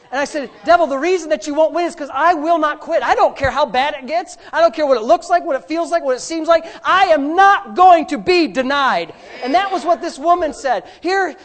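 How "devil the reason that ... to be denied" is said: loud defiant cry at the devil; loud, firm defiance; defiant declaration. Retold or imitated. Retelling defying the devil.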